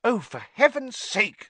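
put-on angry girl voice. A narrator's reading. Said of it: "said Hermione angrily".

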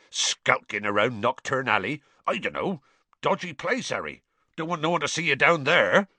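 gruff gamekeeper, rising at the end; Hagrid, gruff scolding. A narrator's reading. Hagrid warns Harry off a dodgy alley, gruff. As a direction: scold the boy in a rustic voice.

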